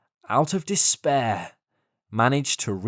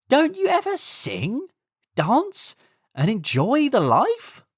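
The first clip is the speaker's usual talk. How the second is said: higher, teasing voice of a carefree grasshopper; mocking grasshopper; 7 semitones higher than usual. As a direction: sneer playfully at the hard-working ants. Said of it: "The grasshopper made fun of the ants and said".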